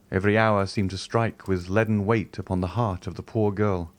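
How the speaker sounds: usual speaking voice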